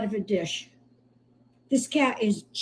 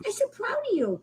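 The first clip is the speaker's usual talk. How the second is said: much higher, gushing cooing; high praise to kitten; 13 semitones higher than usual. Real, not acted. Rescuer praises her kitten, high gushing coo. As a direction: gush over the kitten's progress.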